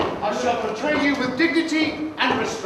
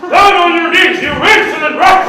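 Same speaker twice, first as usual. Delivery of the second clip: commanding shout; 5 semitones higher, 9 dB louder than usual. Acted. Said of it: "His posture is more like this".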